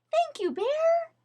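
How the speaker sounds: high, tiny mouse voice; a little mouse thanking his friend, high; tiny grateful mouse; squeaky mouse voice. A narrator's reading. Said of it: "said Mouse".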